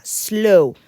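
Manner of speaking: "slow" as one syllable with no E in front; English "slow", a single beat; S glued to L. Shown as a demonstration. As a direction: begin "slow" right on the hiss of S, into L. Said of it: "esa S va muy bien pegadita a la L".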